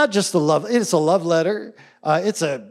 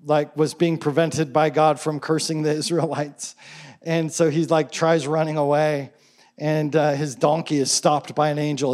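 ordinary talk

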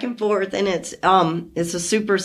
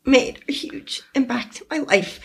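a choked, unvoiced whisper, higher; barely getting the words out through tears; tearful whisper; 5 semitones higher than usual. Real, not acted. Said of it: "I went so emotional about that".

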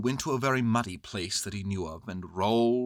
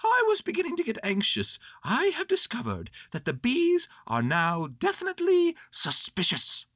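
high, agitated bear voice; Winnie the Pooh; 5 dB louder than usual. Acted. Bear reporting suspicious bees, high anxious voice.

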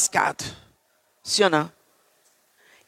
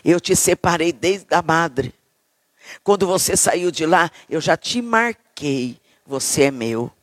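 tender God; 4 semitones lower than usual. Retold or imitated. Preacher voices God comforting Jeremiah, low and tender. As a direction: speak like God soothing his chosen. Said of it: "ele disse".

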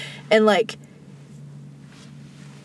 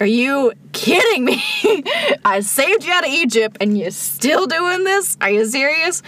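exasperated God; 6 semitones higher than usual. Retold or imitated. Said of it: "I'm paraphrasing".

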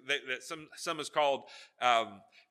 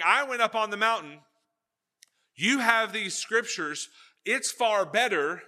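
loud Peter; 8 semitones higher, 8 dB louder than usual. Retold or imitated. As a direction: proclaim it from the mountaintop. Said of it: "he's saying".

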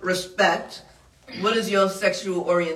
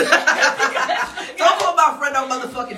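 mock outrage; 5 semitones higher, 4 dB louder, much slower than usual. Mock defending friend, laughing.

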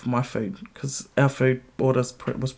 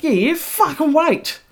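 high, loud, slow yell out the car window; 14 semitones higher, 5 dB louder, slower than usual. Retold or imitated. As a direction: yell back at the honking driver. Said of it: "And I yell out".

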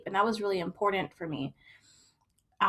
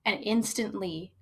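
ordinary talk, nothing marked

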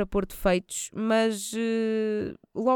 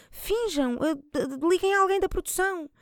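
exasperated high plea; 10 semitones higher than usual. Real, not acted.

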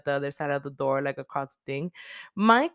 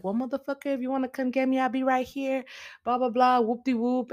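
cocky, higher mock bravado; bold and tough; 7 semitones higher than usual. Sam taunting the killer on the phone, mock bravado. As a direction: dare him, all swagger. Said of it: "acting all bold and tough".

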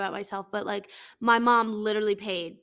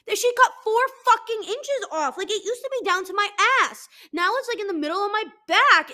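higher, louder indignant rant; outraged rant; 8 semitones higher, 5 dB louder than usual. Real, not acted. Ranting to listeners about the hairdresser cutting four inches, higher and louder. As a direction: squeal out the outrage about the lost hair.

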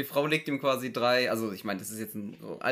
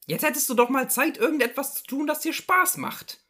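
higher, pestering housewife voice; nagging wife; 13 semitones higher, 6 dB louder than usual. Acted. Wife nudging her resting husband, nagging. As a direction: fuss over him like a nagging wife. S0 plays wife in Loriot sketch.

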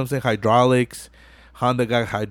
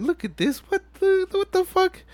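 much higher, squeaky what-the; stunned disbelief; 4 dB quieter than usual. Retold or imitated. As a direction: gawk at the fool in disbelief. [name] stunned at [name].

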